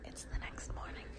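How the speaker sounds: soft unvoiced whisper so nobody wakes. Real, not acted. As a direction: hush it, someone is sleeping nearby. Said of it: "I'm whispering because Megan is asleep".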